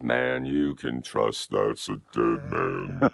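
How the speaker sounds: drawn-out gunslinger through a voice changer; deep voice effect. Pretend. Voice changer, western movie quote.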